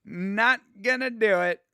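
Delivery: mock digging in, stretch out each word; mock stubborn refusal. Retold fighter refusing to get up.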